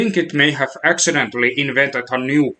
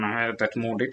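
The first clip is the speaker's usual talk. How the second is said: normal talking voice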